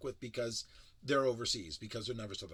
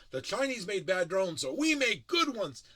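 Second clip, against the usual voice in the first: higher, louder hammed-up boast; mock ad pitch; 5 semitones higher, 7 dB louder than usual. Parodying the drone company, loud smug mock boast. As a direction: crow it like a smug sales pitch. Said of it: "It's not like".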